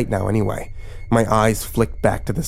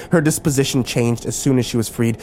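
plain reading voice

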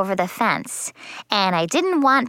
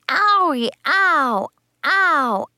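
higher, drawn-in yelps of pain; mock hurt cries; acted pain; 7 semitones higher, much slower than usual. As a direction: play a child's stubbed-toe ows. Kicked a can full of water.

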